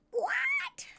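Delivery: cartoon gasp of surprise; shocked squeal. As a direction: squeal it in alarm. [name] shocked, very high.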